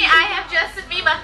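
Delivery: usual speaking voice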